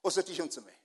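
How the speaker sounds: normal talking voice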